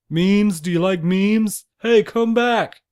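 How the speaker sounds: mock desperate shout for company; desperate eager chat. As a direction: call after someone fleeing the conversation. Imagines desperately chasing a stranger.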